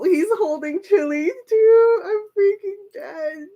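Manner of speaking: high, whiny excited squeal; high-pitched giddy despair; overwhelmed fangirling. Real, not acted. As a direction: wail it as if melting. Overwhelmed fan reacting to photo.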